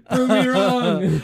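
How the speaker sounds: high, loud and slow, dissolving into a laugh. Real, not acted. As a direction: push the words out through a laugh. Laughing after a joke.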